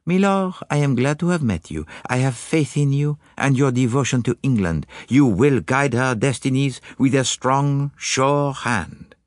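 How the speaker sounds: put-on high, earnest accented detective; Poirot. Detective reassuring the lord, high earnest voice. Poirot's dialogue in narrated story.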